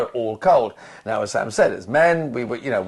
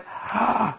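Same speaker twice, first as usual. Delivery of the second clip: high mock gasp of shock; 10 semitones higher, 4 dB quieter than usual. Twenty people on set reacting, with a shocked gasp. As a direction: gasp in exaggerated collective disbelief. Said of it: "we all went".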